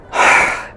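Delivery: acted heavy sigh; big exhaled sigh for a character; sister's sigh. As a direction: let out an audible, breathy sigh. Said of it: "sighs and appeals".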